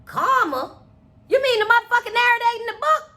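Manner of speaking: high-pitched disbelieving question; incredulous. Acted. Friend questioning what Alice meant by karma, incredulous. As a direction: act out a mildly thrown, puzzled comeback. Acted confused reply to Alice's line.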